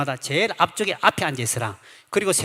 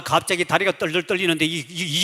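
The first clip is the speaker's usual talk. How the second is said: normal talking voice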